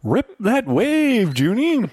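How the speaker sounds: put-on slow, high, drawn-out line from an old screen star; Ricardo Montalban voice. Co-host asks to deliver the movie's best line, slow and high. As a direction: savor every word like a grand veteran actor. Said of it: "That's gonna be my answer to everything".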